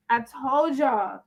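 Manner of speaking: slow, drawn out told; slow emphasis. Real, not acted. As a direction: lean on it slowly. Repeated slowly.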